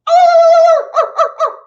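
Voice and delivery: very high, loud yapping barks; yappy dog barks. Retold or imitated. Hyper young dog yaps while running around the yard. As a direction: yap like a spastic little pooch. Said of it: "It's all like".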